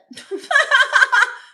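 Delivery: high, loud burst of laughter; real high-pitched cackling laugh. Real, not acted. Laughs at own accent attempt.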